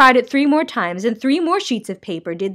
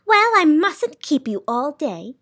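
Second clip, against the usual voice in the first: higher, drawn-out dismissal; slow, pointed impatience; impatient; 6 semitones higher than usual. A narrator's reading. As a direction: stretch it out impatiently. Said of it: "she said impatiently".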